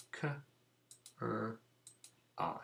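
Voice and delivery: K, R, I as separate phonics sounds, long pauses between; sounding out. Shown as a demonstration. Teacher putting sound buttons in cry, K, R, I sounded separately. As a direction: sound out K, R, I one at a time, as "kuh, rr, eye". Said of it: "put the sound buttons in".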